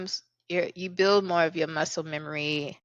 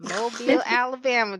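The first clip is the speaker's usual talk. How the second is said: high, louder drawled Mobile, Alabama; sing-song Southern twang; 6 semitones higher, 4 dB louder than usual. Real, not acted. Host introducing her Southern guest, in a drawled twang. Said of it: "I don't know why I said it like that".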